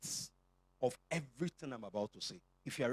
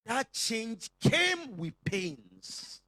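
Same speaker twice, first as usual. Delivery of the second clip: very slow, loud and high; slow emphatic; 6 semitones higher, 6 dB louder, much slower than usual. Real, not acted. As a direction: stretch every word out. Stressing painful change, drawn out.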